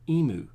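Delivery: emu with no y-glide, EE-moo; American mispronunciation. Shown as a demonstration. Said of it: "a lot of Americans don't know the word".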